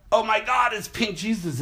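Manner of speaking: mock a stunned onlooker; hammed-up astonished cry; amazed crowd. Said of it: "They'd say".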